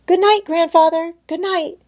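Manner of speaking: put-on high, bright child voice; little girl Heidi. A narrator's reading. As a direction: play an eager little girl.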